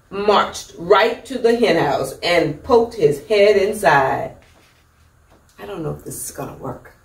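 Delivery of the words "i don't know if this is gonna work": much softer, fast, lower doubtful aside; muttered aside. Real, not acted. Reader aside to children doubting the peacock, hushed and fast. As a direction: mutter your doubt quickly. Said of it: "reader's doubtful aside".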